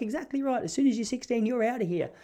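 high, sharp mum laying down the law; mock scolding mum. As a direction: lay down the law like a fed-up mum. Mum voiced in a fight.

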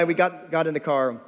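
ordinary talk, nothing marked

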